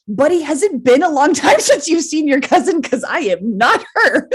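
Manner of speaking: loud, amused disbelief with a laugh in it; laughing retort. Retold or imitated. Mocking the supposed cousin.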